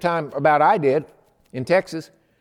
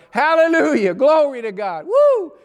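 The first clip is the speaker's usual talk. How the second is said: rejoicing praise; 4 semitones higher than usual. Retold or imitated. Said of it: "our van is going".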